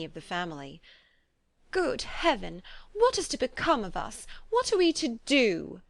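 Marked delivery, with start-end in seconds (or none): 1.7-5.8 bitter lament. A narrator's reading. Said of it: "would they often exclaim in the bitterness of woe".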